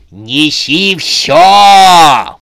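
drawn-out roaring yell; furious shout. A narrator's reading. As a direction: bellow it, very slow and loud. Susanna loses patience, yells.

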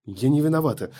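panicked cry. Acted. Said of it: "продолжала кричать".

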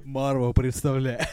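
slow, booming announcer through a laugh; movie trailer voice. Retold or imitated. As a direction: drawl it like a dubbed trailer. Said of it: "русскую озвучку трейлера". (голос закадрового диктора трейлера)

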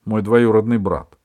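loud, merry explanation; cheery and emphatic; cheerful. A narrator's reading. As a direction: announce it brightly and loudly. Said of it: "весело сказала Катя".

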